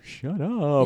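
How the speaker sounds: high, put-on snap; imitating Carl. Carl shuts Homer down, high put-on voice. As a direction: fire it off up high. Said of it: "And Carl's like".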